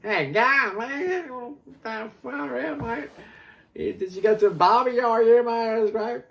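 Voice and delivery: high, loud, hammed-up Aussie bloke; exaggerated Aussie barbecue chatter; broad Australian accent. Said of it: "that's how they say it in Australia".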